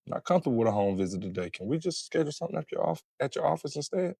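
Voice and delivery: soft, quick, polite request; calm and collected. Shown as a demonstration. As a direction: set the boundary gently, no edge. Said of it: "Just calm, collected".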